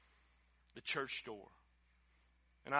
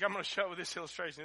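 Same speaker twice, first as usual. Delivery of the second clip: ordinary delivery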